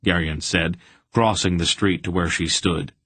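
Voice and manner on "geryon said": plain reading voice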